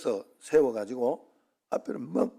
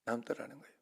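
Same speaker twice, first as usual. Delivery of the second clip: 남더라는 거예요 repeated much softer, to himself; quiet echo; 4 semitones lower, 14 dB quieter, faster than usual. Real, not acted. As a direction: let 남더라는 거예요 fall away softly as an afterthought. (남더라는 거예요를 혼잣말처럼 조용히 반복)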